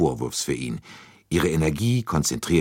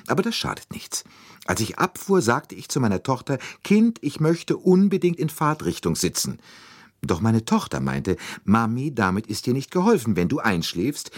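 higher-pitched, garrulous lady's voice; chattering lady passenger; 8 semitones higher than usual. A narrator's reading. Lady telling the men about her daughter's advice, chatty. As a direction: rattle on about your daughter and the train.

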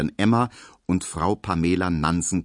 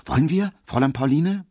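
eager plea; 9 semitones higher, 4 dB louder, faster than usual. A narrator's reading. Emil pressing his invitation.

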